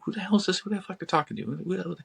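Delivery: high, alarmed squeak of a startled demon. Acted. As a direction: pitch up in shocked disbelief, then trail off.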